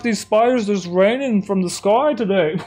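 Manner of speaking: a cheerful Aussie forecast done badly; bad Australian accent. Streamer giving a spider weather report, bad Australian accent. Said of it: "It's completely wrong".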